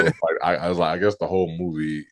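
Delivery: ordinary talk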